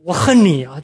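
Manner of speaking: put-on a child's angry yell of I hate you; angry shout. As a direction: shout it out in anger. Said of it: "他就很气". (孩子生气地大喊)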